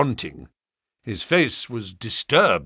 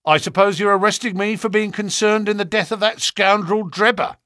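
brash, higher and louder defiance; cocky, unafraid retort; bold as brass; 9 semitones higher, 4 dB louder than usual. Acted. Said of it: "he answered us as bold as brass".

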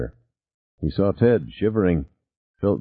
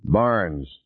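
plain reading voice

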